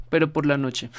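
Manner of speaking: ordinary delivery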